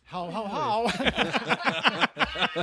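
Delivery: loud, high, booming Santa ho-ho; laughing Santa impression; mock Santa laugh. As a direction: belt out a jokey Santa laugh. Booming Santa laugh after Santa joke.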